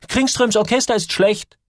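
hohe, drängende Jungenstimme; aufgeregt dagegenhalten. A narrator's reading. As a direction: platz mit dem Einwand hoch heraus. Joel will Samuel eilig abbringen. (aufgeregter Junge, deutlich höher)

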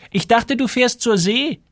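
very high-pitched woman's call; shopkeeper woman. A narrator's reading. Shopkeeper Frau Enström questions Joel. (hohe Stimme einer Ladenfrau)

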